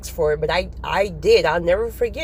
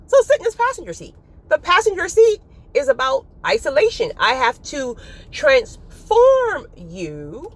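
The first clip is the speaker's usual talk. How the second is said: higher, louder voice of God, slowing at the end; lightly voiced God giving instructions; God speaking; 7 semitones higher, 3 dB louder than usual. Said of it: "He's like".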